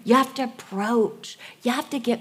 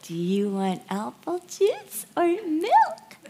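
put-on higher, slow, small childish voice; mimicking small child; 8 semitones higher than usual. Parent imitating her child answering, high and slow. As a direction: imitate a timid little child.

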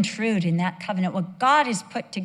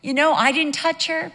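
high, loud defensive retort; protesting innocence; 8 semitones higher, 6 dB louder than usual. Retold or imitated. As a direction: plead innocence loudly as the accused king. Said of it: "Abimelech then declares his righteousness".

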